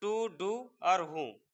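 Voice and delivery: slow, careful drill of each syllable; slow spelled-out sounds. Shown as a demonstration. Teacher sounds out tu du hun slowly for students. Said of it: "फिर वही system".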